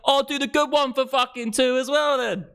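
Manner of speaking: very high, strained competitive yell; desperate seller yelling a counter-offer; mock shouting. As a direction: outbid the rival at a hammed-up shout. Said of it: "Yeah, so I'm shouting".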